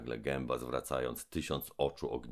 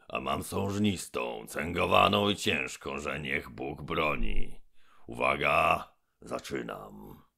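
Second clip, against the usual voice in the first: menacing giant mouth; 5 dB louder, slower than usual. Acted. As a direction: boom down from above. Gębon threatens.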